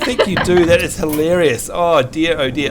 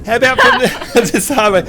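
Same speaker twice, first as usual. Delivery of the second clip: higher, broken up by laughter; laughing; 5 semitones higher than usual. Real, not acted. Host cracks up restarting the demo, laughing. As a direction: try the phrase through giggles.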